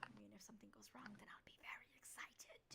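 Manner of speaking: hushed eager whisper. Acted. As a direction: breathe it out in a sly whisper. Axe whispering aside.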